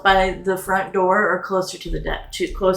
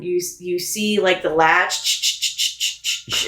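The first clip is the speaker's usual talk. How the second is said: ordinary talk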